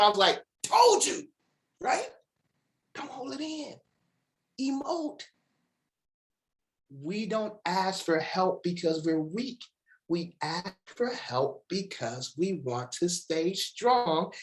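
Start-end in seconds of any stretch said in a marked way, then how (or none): 0.6-5.2 very high gleeful proud-father voice; proud papa. Retold or imitated. Proud father teasing son after interceptions, very high and gleeful. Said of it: "my proud papa moment". 6.9-14.3 slow emphatic. Acted. Repeats key line slowly.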